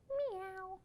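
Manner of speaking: soft high kitten meow. Acted. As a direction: mew like a little kitten. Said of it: "cats and kittens say".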